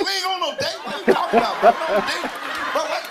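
high, incredulous mock protest; baffled comic outburst; shocked denial. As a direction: protest in hammed-up disbelief. Acting out shocked denial of a date.

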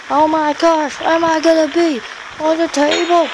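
high-pitched panicky outcry; hammed-up worried high voice; playful alarm. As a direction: squeal it in mock alarm. Alarmed at table move in game.

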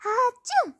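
high, loud acted sneeze "Achoo"; big sneeze. A narrator's reading. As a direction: let out a huge sneeze "Achoo". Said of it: "I sneezed".

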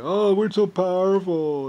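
slow, high, loud, puffed-up sneer; pompous mockery. Mocking people who think they're powerful, slow and pompous. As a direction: ham up their smug self-importance. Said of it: "all these people are like".

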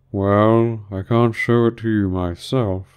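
slow, drawn-out creature voice; doleful Mock Turtle. A narrator's reading. Mock Turtle declining to demonstrate, slow and doleful. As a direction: draw it out regretfully.

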